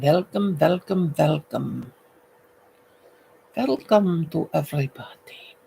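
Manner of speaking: "velcome" in a hammed-up Romanian accent. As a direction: welcome them like a mock Romanian host. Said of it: "use my most famous Romanian accent".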